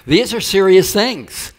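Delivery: laughing through a mock-serious line; laughing while calling it serious. Real, not acted. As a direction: chuckle it out, slowed and high.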